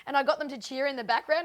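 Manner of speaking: normal talking voice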